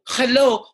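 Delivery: "hello" with a harsh throaty KH; imitated Spanish H. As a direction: start "hello" with a guttural rasp. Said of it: "with their strong accent".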